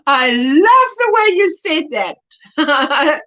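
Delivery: usual speaking voice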